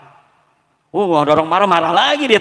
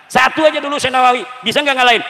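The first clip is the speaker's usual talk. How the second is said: fast, higher taunting question to the crowd; daring the audience, quick and raised; mock challenge; 7 semitones higher, faster than usual. Preacher taunts listeners: fast raised challenge.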